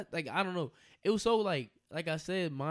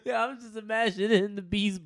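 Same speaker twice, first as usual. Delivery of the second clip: ordinary talk, nothing marked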